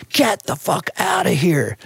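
high, slow imitation of a New York local; put-on American street voice; stranger's urgent warning. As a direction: drag out each word of the warning. Stranger warns him off.

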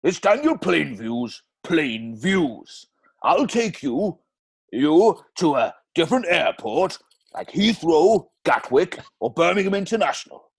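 sing-song Welsh voice; Daniel Plainview gone Welsh; Welsh accent. Acted. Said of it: "That's fucking Welsh".

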